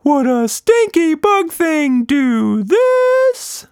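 high, loud, slow showman shout; showy shout. A narrator's reading. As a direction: proclaim it with flair. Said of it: "Quatch Monster shouted".

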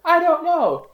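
high stunned I don't know. Retold or imitated. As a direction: blurt it out in a daze. Stunned reply to proposal.

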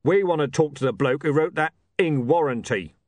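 forceful, menacing heavy; hard-hitting thug voice; thuggish Mister Tulip. Acted. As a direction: push each word out as a threat.

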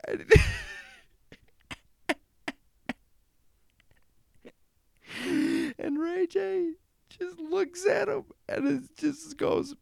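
squeaky, high-pitched laughter through the words; can barely get the story out for laughing; real giggling fit while retelling the clip; laughing while talking. Real, not acted. Cracking up at the video.

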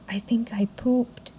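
hushed retold confession; sheepish whisper. Wife confesses to husband on waking, sheepish whisper. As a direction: whisper it like an embarrassing secret.

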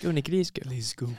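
understated put-on Kiwi accent; George Beamish impression. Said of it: "do a George Beamish impression of".

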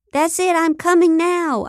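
high, put-on announcement of pleasure; unconvincing fake climax. A narrator's reading. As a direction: fake it hopefully and not very well. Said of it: "hoping it wasn't as unconvincing as it sounded".

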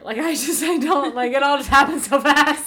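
overwhelmed. Real, not acted. Overwhelmed rant about moving.

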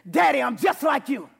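loud, high declaration; call and response shout. Shown as a demonstration. Pastor leading congregation, loud declaration. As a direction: lead the church in the shout. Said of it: "Can you do that with me? Say".

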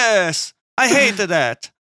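put-on high, hammy Italian accent; mock Italian accent. Cohost chimes in with a hammy Italian accent. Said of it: "A hundred percent legitimate Italian accent".

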